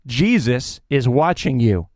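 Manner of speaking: mysterious voice again. A narrator's reading. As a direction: lean into the ghostly warning. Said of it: "he hears the voice again".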